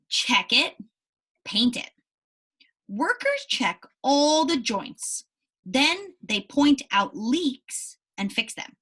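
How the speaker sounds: slow, careful read-aloud narration; story reading. Acted. Teacher reading the ship story to her class, slow narration. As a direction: read each phrase clearly for young listeners.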